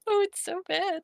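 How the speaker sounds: high, giggly and breaking up; cracking up while talking; laughing. Real, not acted. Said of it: "I started pissing myself laughing".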